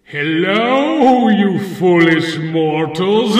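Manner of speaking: loud, slow horror host voice; spooky vampire host. Acted. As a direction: greet them like a haunted house host. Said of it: "I am Count Brian Garnula".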